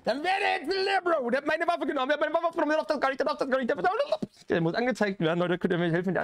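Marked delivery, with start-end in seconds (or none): 0.0-4.3 high, wailing gamer protest; frustrated outburst. Real, not acted. Gamer robbed of the weapon after a near miss, high indignant wail. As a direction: start in a long high cry, then rattle off indignant complaints. Narrowly loses fight, weapon taken.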